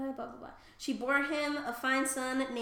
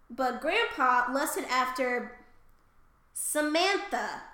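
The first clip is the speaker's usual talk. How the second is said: slow careful name; 4 dB louder, much slower than usual. Real, not acted. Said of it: "It's P S A M A T H E".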